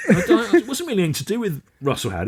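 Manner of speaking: ordinary delivery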